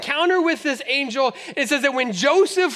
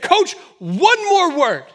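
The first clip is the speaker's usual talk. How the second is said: ref final warning; 4 semitones higher, 4 dB louder, slower than usual. Retold or imitated.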